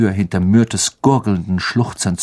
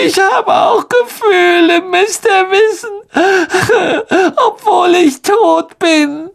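high, choked and sobbing; tearful; 4 dB louder than usual. Acted. Myrte to the three, high and choked with tears. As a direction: near tears, wounded.

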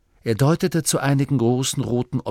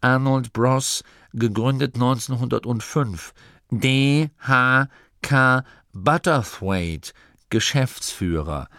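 slow, higher reading aloud, dropping at the end; ehrfürchtig vorlesend; 4 semitones higher than usual. A narrator's reading. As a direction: read each part out with solemn care. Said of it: "Gurda schluckte und las". (langsam und feierlich abgelesen)

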